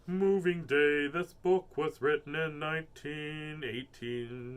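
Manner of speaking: ordinary delivery, nothing marked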